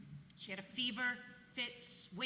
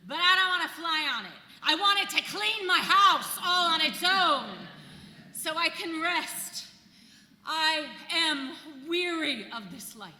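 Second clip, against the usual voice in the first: fervent wish; 4 semitones higher, 9 dB louder than usual. Retold or imitated. Her own wish, building up.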